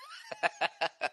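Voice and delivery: high giggle. Real, not acted. Laughing at own boast.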